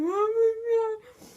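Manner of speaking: high, loud, slow strained cry; wailing oh my God. Retold or imitated. Crying teen facing her parents, high drawn-out wail. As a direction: stretch it out in a sobbing wail.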